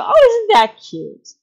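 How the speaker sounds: put-on high, slow baby-talk coo. Parents cooing at their toddler's odd behaviour, high and slow.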